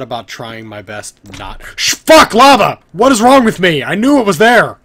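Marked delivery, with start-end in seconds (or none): 1.8-4.8 loud, high panicked shouts; panicked yelling. Real, not acted. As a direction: yell in sudden panic. Falls into lava suddenly.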